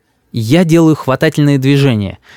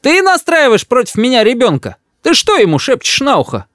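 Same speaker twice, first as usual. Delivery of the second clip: high-pitched jealous accusation; angry cry; 12 semitones higher than usual. A narrator's reading. Grandmother accuses grandfather of turning child, angry. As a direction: confront him, pitched high. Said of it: "кричит бабушка дедушке".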